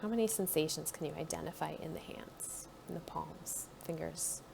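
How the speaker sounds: calm guided body-scan voice; guided meditation. Acted. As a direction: ease the listener inward, gently.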